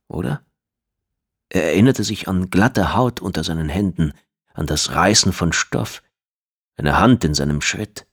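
usual speaking voice

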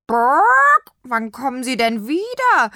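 high, loud clucking hen. A narrator's reading. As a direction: ask like a curious hen. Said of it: "fragte Nofretete".

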